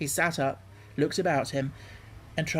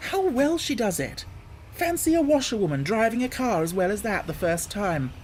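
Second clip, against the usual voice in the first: higher, delighted praise; admiring gentlemen; 6 semitones higher than usual. Acted. Gentlemen praising the washerwoman's driving, delighted. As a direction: marvel aloud.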